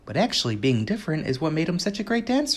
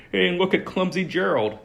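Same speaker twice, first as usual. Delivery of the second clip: mocking jeer, higher and louder; sneering; 6 semitones higher, 3 dB louder than usual. Jungle animals mocking the giraffe, sneering. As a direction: sneer at him from the crowd. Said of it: "the animals all sneered".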